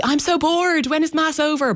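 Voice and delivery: high-pitched mock complaint, not truly loud; retold loud whining complaint. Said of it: "probably saying very loudly".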